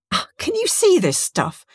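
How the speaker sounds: high, startled question; alarmed question. A narrator's reading. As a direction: ask it in sudden alarm. Bernice hallucinating, alarmed.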